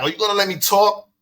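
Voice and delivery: lightly retold angry demand; confronting, in his face. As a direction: push right up into his face with it. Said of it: "so I get in his face".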